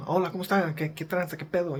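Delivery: fluent Mexican Spanish accent; native Mexican Spanish accent. Shown as a demonstration. Showing the real Mexican Spanish accent to listeners. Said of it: "with the accent".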